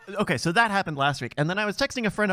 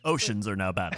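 low, flat lightly voiced de Blasio; Bill de Blasio impression; 8 semitones lower than usual. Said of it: "good Bill de Blasio impression".

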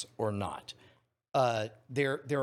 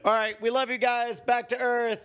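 loud sign-off; 9 semitones higher, 6 dB louder than usual. Real, not acted. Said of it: "show sign-off".

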